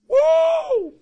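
sudden high whoop; playful whoop. Acted. Celebratory whoop.

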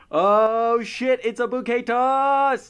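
high, slow excited outcry; excited shout. Pretend. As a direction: burst out in mock surprise. Excited rap outburst.

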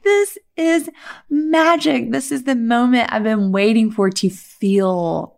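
high awestruck joy; awed delight. Retold or imitated. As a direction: marvel at pure magic. Ecstatic spiritual moment retold.

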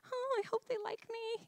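high, soft, anxious little voice; nervous. Retold or imitated. New girlfriend dreading meeting the in-laws, nervous. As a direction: fret in a small high pitch. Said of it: "so nervous".

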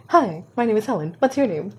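awkward blurt. Retold or imitated. Teen greeting the lonely boy, nervously blurting. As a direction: blurt the rehearsed line too eagerly. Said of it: "really awkwardly".

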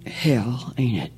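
low, whispered fatherly aside; quiet father comfort. Acted. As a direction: say it softly, man to man.

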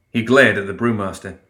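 ordinary delivery

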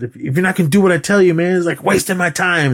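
imitating someone mad at a student; retold exasperation. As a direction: act out a fed-up teacher losing patience. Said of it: "he got mad 'cause Daniel was doing it".

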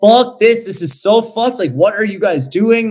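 high, loud exasperated outburst; retold outrage. Retelling watching police hit people, angry outburst. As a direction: let the outrage break out. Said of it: "I was just like".

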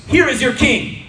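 ringing, high public declaration; Pilate presenting the king. A narrator's reading. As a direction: proclaim it to the crowd. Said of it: "He said to the Judeans".

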